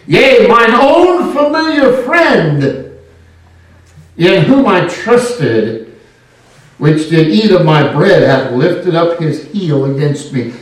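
loud, slow preaching of scripture. Acted. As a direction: proclaim it from the pulpit.

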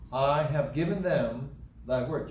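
slow reading, weighing "I have given them"; emphatic scripture reading. Shown as a demonstration. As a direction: spell out "I have given them thy word" slowly, with emphasis. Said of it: "I'll emphasize certain words here".

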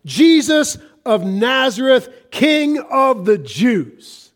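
loud, slow solemn title; slow ringing declaration; slow proclamation. Acted. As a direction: proclaim each word like an inscription.

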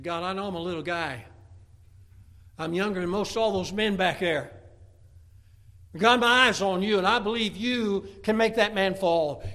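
from plain to loud, high and fervent; building courage. Acted. David praying before the battle, rising to a loud bold cry. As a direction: start humble then swell into bold faith.

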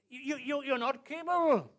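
high, loud, stammering protest; hammed-up incredulous objection; spluttering disbelief. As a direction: sputter in outraged disbelief. Acted objection to young David.

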